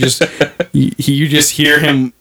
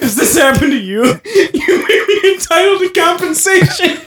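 mock lawyer ad; 11 semitones higher than usual. Parody lawyer ad.

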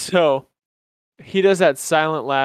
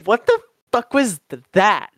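higher, incredulous mock outrage; angry girlfriend; 5 semitones higher, 4 dB louder than usual. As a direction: demand it like she can't believe him. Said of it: "She goes".

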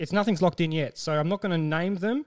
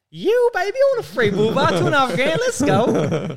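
whooping it out high and loud; gleeful celebration; 10 semitones higher, 10 dB louder than usual. Retold or imitated. Customer celebrating a free bull bar in his head, gleeful shout. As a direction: secret jubilation bursting out at full volume. Acting out his secret inner glee.

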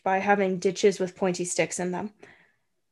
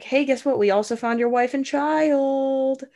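higher, gloating "guess what" taunt; mock taunting; 4 semitones higher than usual. English troops gloating to Robert, higher taunting voice. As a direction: rub it in like a smug enemy soldier.

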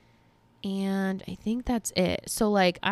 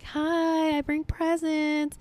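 cheery arrival; 9 semitones higher, much slower than usual. Retold or imitated. Arriving at her parents with plants.

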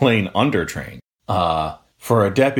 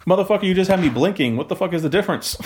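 higher, fast incredulous rant; exasperated retort; 10 semitones higher, faster than usual. Retold or imitated. Recruit snapping back at his trainers, fast high exasperation. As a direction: fire it back in disbelief.

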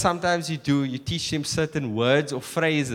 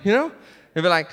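ordinary delivery

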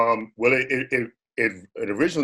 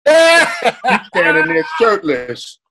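laughing; 4 semitones higher, 5 dB louder, much slower than usual. Real, not acted. Teasing friend about shirtless show.

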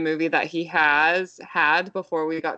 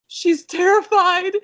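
higher, louder, slow mock alarm from the dad; alarmed husband; 12 semitones higher, 7 dB louder, slower than usual. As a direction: play the panicked dad, drawn out. Husband's alarmed text, retold.

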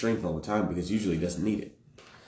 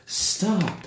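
high-pitched imitation of a raised alarm; retold alarmed cry; 9 semitones higher, 6 dB louder than usual. Hypnotized lady calls from her window, mock alarmed cry. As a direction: mimic a high, loud cry for help. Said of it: "busts out of her window and is like".

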